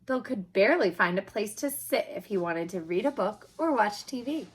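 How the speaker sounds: ordinary delivery, nothing marked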